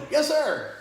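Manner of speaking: loud, high military acknowledgment; recruit's shouted reply. Retold or imitated. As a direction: bark it out to a drill instructor. Acted shouted answer to drill instructor.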